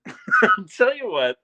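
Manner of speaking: slow, laughing repeat. Real, not acted. Owner laughing at failing the boop, slow. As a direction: chuckle through it slowly.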